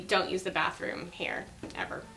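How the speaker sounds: hushed mock warning; whispering. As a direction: lean in and whisper it. Confiding a bathroom warning.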